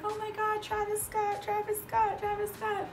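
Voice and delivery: high-pitched mock fan chant; star-struck crowd imitation, kept at talking volume; imitating excited fans. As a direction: squeal the star's name like a crowd of fans.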